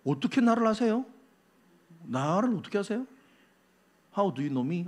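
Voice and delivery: hushed, slightly wondering question; astonished. Acted. Nathanael asking Jesus how he knows him, hushed astonishment. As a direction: ask softly, as if taken aback. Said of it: "깜짝 놀랍니다".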